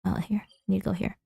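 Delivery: ordinary talk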